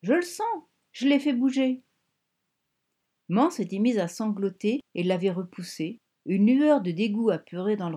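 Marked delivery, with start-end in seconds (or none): 0.0-1.8 put-on high, loud child's cry; cried out. A narrator's reading. A girl touching her mother's belly, crying out high. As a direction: shout it up high like an excited little girl. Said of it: "avait-elle crié".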